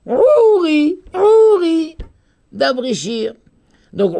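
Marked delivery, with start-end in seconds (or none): 0.1-2.0 "Ouri" with high, long, emphatic "Ou"; stress on the first syllable. Shown as a demonstration. Teacher demonstrates first-syllable accent, "Ouri" stressed on "Ou". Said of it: "sur la première syllabe".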